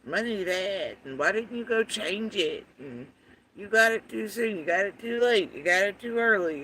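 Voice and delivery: mocking mimicry. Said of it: "this stupid drunk bitch going on with".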